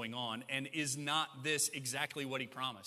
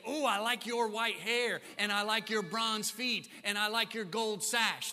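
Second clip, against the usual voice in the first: hammed-up fawning praise, higher and louder; mock gushing compliments; 6 semitones higher, 5 dB louder than usual. Heavenly beings mock-praising Jesus' hair and feet, gushing. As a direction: gush over his looks like a shallow fan. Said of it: "they don't say".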